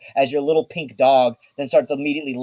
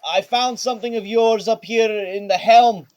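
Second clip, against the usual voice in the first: Baco calling; 8 semitones higher than usual. Acted. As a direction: call out brightly to Miss Sakura. GM voices Baco handing dog.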